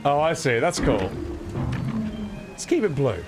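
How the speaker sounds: ordinary talk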